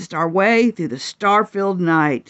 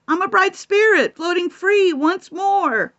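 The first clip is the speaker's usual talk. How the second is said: chicken voice; 10 semitones higher than usual. Acted. Chicken telling the others in a hen voice. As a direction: chirp it out like a happy hen. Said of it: "Chicken said".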